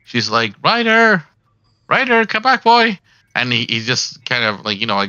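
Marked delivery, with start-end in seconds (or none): 0.6-3.0 high, loud calling for the dog; old lady calling her corgi back home; grandma calling out. Acted. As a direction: shout across the yard for your dog.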